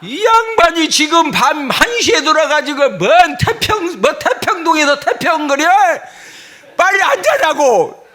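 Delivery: high, loud mock scolding; hammed-up marital tirade; nagging wife. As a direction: play a fed-up wife telling off her husband. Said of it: "부인들이 받아줘".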